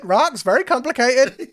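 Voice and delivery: same squeaky bird creature, pushed harder; high creature voice. Acted. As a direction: chirp it up high again. GM repeats Lintu line.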